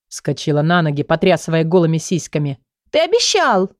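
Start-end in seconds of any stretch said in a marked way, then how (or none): 2.9-3.7 high, pained cry of blame; shrill reproach. A narrator's reading. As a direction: wail it out as a jilted girl. Girl cries out in reproach.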